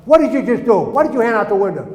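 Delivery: loud, high, accusing demand; angry guard. Retold or imitated. A camp guard catching a boy at the window, angry shout. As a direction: act out a guard's shouted interrogation.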